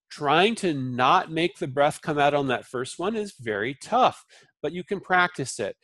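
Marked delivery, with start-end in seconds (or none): none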